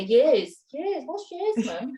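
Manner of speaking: put-on high, childish teasing "years, what's years"; kids teasing. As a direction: mimic the kids mocking mum's "years". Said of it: "my kids always say".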